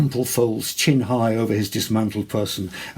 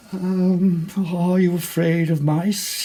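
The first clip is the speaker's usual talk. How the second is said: high, slow, hesitant and mortified; embarrassed venture; 9 semitones higher, 5 dB louder, slower than usual. A narrator's reading. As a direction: ask it slowly, blushing scarlet. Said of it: "he ventured, growing ... more scarlet".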